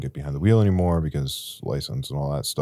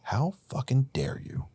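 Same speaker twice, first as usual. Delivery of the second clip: hissed rebuke; 9 semitones higher, 4 dB quieter, slower than usual. Retold or imitated. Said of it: "I start yelling at the sky".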